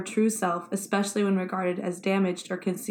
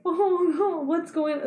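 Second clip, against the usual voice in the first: high, worried "oh no"; gently alarmed, a little higher; surprised; 9 semitones higher, 3 dB louder, slower than usual. Retold or imitated. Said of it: "And I was like".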